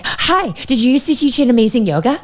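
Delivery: high, eager gush blurted at a stranger; word vomit. Retold or imitated. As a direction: blurt it out high and overeager, like a nervous fan. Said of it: "word vomit was like".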